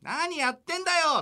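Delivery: high, loud exasperated shout; mock outraged yell. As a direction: yell at the blunder. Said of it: "っつって". (呆れて怒鳴る)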